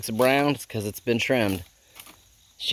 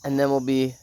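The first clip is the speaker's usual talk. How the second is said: usual speaking voice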